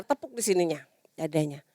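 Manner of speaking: usual speaking voice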